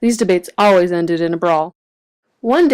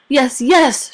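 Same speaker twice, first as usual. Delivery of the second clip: shout; 5 semitones higher than usual. A narrator's reading. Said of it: "shouted Grump".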